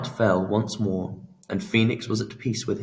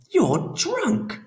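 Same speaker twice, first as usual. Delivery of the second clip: higher, startled exclamation; exclaiming. A narrator's reading. Laura exclaiming at tipsy Pan, higher and startled. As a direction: blurt it out, alarmed. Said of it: "exclaimed Laura".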